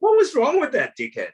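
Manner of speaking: high, put-on voice of an adult recalling a childhood memory; grown-up kid remembering. A grown-up former pupil remembering the costumed man in a lightly mocking high voice. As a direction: squeak it out, mock-puzzled. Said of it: "they go".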